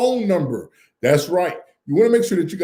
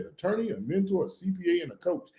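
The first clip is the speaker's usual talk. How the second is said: quiet recap; 8 dB quieter than usual. Shown as a demonstration. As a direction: murmur the four names back as a quote. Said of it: "He said".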